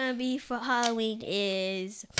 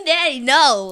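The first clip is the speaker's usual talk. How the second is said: laughing shriek, much higher and louder; loud protest; 3 semitones higher, 18 dB louder than usual. Real, not acted. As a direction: cry out at daddy, half laughing. Daughter protests dad's boring remark.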